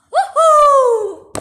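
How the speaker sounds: high, loud cheer; excited whoop. Acted. Character cheers in play, excited whoop. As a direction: let out a big whoop.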